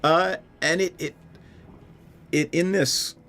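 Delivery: usual speaking voice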